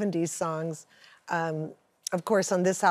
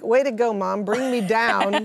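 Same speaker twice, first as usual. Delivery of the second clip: louder, slowing on bring me down, mock dismay; mock deflated; 2 semitones higher, 5 dB louder than usual. Daughter complaining to mom about the gloomy song meaning, mock glum. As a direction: tease mom for the sad answer. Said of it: "And I was like".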